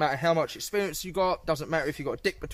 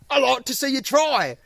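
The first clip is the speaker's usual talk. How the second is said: high, loud goading retort; acted challenge; 10 semitones higher, 7 dB louder than usual. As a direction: throw the dare back as in a row. Acting out doorman's challenge back.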